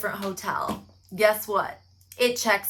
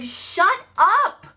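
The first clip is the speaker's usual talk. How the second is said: loud, much higher shout; shouting shut up; 12 semitones higher, 10 dB louder than usual. Retold or imitated. Lawyer silencing his bragging client, loud shout. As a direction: bark it to stop the client talking. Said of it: "His lawyer's like".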